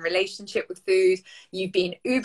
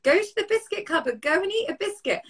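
much higher, coaxing craving voice; tempting inner voice; 11 semitones higher than usual. Retold or imitated. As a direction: voice the biscuit craving whispering in your ear, pitched way up. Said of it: "the voice is like".

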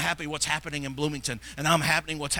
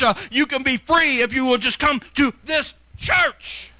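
loud, high, driving exhortation; full-force preaching; 6 semitones higher, 8 dB louder than usual. Real, not acted. Preacher urging congregation to tell about their church, fervent shouting. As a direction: build to a shouted, punched finish.

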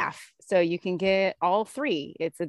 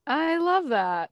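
higher, slow, drawn-out delight; gushing; 9 semitones higher, slower than usual. Real, not acted. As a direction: savour each word with delight. Repeats it gushing.

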